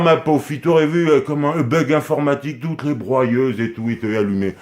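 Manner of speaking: ordinary talk, nothing marked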